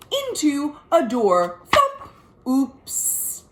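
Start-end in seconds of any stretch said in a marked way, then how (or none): 1.7-2.1 high, loud playful thump; sound effect thump. Acted. Voicing Elizabeth hitting the door, loud playful thump. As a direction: bump sound for the kids.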